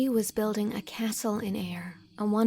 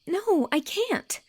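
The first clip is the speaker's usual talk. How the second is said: much higher, louder and slow; desperate exclamation; 12 semitones higher, 7 dB louder, slower than usual. Acted. Anne refusing Gilbert's hope, desperate. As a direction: cry it out in desperation. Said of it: "exclaimed Anne desperately".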